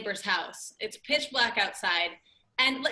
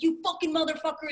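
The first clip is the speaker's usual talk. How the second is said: higher-pitched mock furious cursing; imitated angry confrontation; 6 semitones higher, faster than usual. Man with a bat to dad, mock angry cursing. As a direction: get in his face, as the neighbor. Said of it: "getting in his face".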